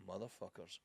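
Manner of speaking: quiet bitter mutter; muttered curse. Retold or imitated. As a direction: curse it under your breath. I was like.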